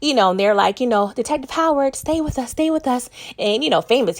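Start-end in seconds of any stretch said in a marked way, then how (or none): 1.1-3.1 lightly acted high-pitched urgency; urgent pleading. Medics to wounded detective on the gurney, mildly urgent imitation. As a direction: imitate a medic pleading, without raising the volume.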